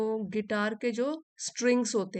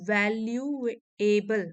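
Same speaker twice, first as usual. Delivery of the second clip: Valuable broken into long, deliberate syllables; 4 dB louder, much slower than usual. Shown as a demonstration. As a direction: sound out valuable slowly so each syllable can be counted. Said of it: "इसमें आप बोल के देखोगे". (Valuable को syllable में तोड़कर बोलना)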